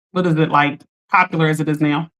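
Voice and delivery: ordinary talk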